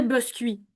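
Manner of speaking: biscuit said as bœskɥi, the I drifting toward eu; almost a rounded vowel. Shown as a demonstration. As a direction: let the I in biscuit slide toward eu. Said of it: "si on relâche encore plus".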